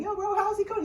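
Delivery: high-pitched mock buddy greeting; chummy bro. Preacher pretending to message his friend David, high chummy voice. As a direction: toss it out like a goofy pal.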